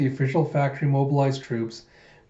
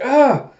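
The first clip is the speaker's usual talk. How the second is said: short, high, loud scream; startled scream; 12 semitones higher, 8 dB louder than usual. Acted. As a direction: cry out in alarm. Koyomi cries out as Den rampages.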